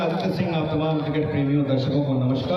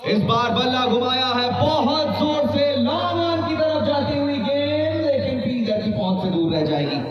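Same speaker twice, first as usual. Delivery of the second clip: high, rising call as the ball flies; excited cricket commentary; 10 semitones higher than usual. Real, not acted. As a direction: build up the big shot. Big shot in the air.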